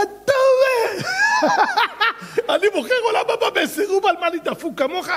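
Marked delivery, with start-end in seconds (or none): 1.1-2.5 loud high laugh. Real, not acted. Preacher laughing at his tale. 2.5-5.1 high, laughing scornful man; mock him through laughter; laughing mockery. Voicing mocking man in story.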